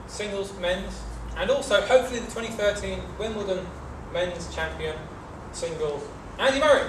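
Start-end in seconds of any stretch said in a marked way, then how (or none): 6.4-6.8 announcer. Real, not acted. Announcing a guest for applause.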